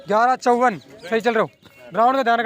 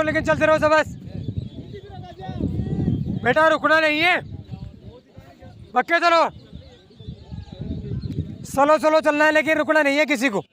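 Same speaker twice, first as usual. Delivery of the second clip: higher-pitched coach's call, urging runners on; calling out encouragement; 4 semitones higher than usual. Real, not acted. Coach urges tiring runners not to stop, calling out. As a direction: call across the track to keep them moving.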